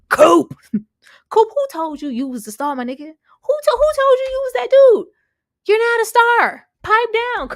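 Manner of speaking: high, loud call-out of a player; mock yelling coach. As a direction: yell at Coop to pipe down. Voicing the coach calling out Coop.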